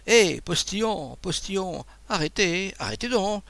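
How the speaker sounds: calling out. A narrator's reading. Abbé hails coachman to stop.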